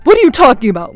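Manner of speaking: loud, exasperated challenge; angry. Acted. Mother-in-law reacts to fake tears, loud anger. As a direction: fire the question back angrily. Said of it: "she became even more angry".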